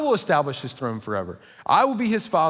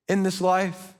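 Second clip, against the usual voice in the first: usual speaking voice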